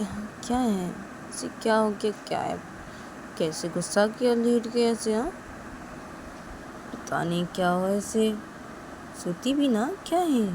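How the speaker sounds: soft, half-whispered puzzlement; quiet friend wondering about sleeper; hushed gossip. Acted. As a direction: whisper so she doesn't wake. Vikas whispering about Shruti.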